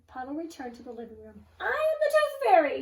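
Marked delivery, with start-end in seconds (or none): 1.6-2.8 high, loud proud announcement; grand announcement. A narrator's reading. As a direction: proclaim it grandly. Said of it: "he announced".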